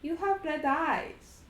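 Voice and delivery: put-on a boy pointing out something odd; little Strahd. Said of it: "And little Strahd says".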